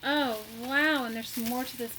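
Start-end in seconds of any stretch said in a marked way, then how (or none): none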